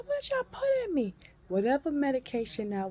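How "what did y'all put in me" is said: fast, high, louder disbelief; retold alarmed demand. Patient questioning staff about the drug, alarmed demand.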